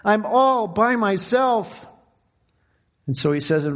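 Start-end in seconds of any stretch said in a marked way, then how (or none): 0.0-1.9 high, drawn-out lament to God; crying out. Retold or imitated. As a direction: act out gushing complaint, slow and pitched up. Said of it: "He's crying out. He's just gushing out".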